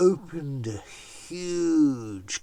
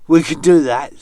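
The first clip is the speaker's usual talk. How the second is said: loud, high, keen shout; eager children; 7 semitones higher, 19 dB louder, faster than usual. Acted. Children agreeing to Anansi's plan, loud and eager. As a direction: call it out like keen kids.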